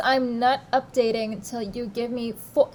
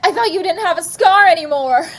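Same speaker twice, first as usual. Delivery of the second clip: loud, fast, higher shout; 6 semitones higher, 9 dB louder, faster than usual. Acted. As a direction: yell it in disbelief. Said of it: "I shouted".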